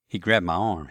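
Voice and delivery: plain reading voice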